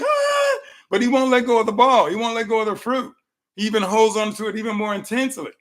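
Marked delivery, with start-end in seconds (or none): 0.0-0.6 high-pitched mock scream; mimicked animal yell, only slightly louder; imitated monkey scream. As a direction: let out a short, high held "ah" like a trapped monkey. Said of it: "he'll yell and scream".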